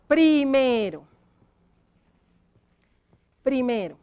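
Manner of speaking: "Primero" drawn out while writing; slow dictation. Shown as a demonstration. Teacher dictates the word to write, slow dictation. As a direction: stretch "Primero" slowly for writing. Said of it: "entonces vamos a escribir acá".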